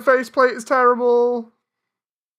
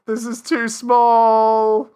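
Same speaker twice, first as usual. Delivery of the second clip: drawn out; 5 dB louder, much slower than usual. Real, not acted. Dismayed at drawing.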